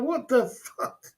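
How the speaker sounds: slow, high, drawn-out disbelief; stunned disbelief. Retold or imitated. Reacting to a friend drinking toilet water, slow high disbelief. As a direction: stretch it out, floored. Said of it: "I'm like".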